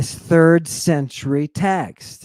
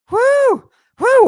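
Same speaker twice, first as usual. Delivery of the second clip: loud, high whoop of excitement; excited whoop; 9 dB louder than usual. Real, not acted. Hyped lecturer cheering.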